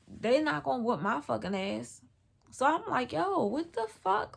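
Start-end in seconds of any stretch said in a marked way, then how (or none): 3.1-4.4 higher, drawn-out disbelief; startled protest. Retold or imitated. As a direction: stretch it out, incredulous. Startled protest in retold story.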